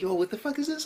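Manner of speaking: high-pitched disbelief; bewildered reaction. Retold or imitated. Reacting to the sailor outfit, bewildered. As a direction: retell it in high, baffled protest.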